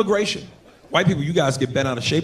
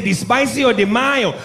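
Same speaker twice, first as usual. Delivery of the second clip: mock Mexican accent; 6 semitones higher, slower than usual. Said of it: "He said".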